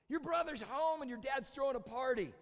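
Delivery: high, loud voicing of the news; excited announcement. Servant tells older brother of the party, excited announcement. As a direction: call out the big news as the servant.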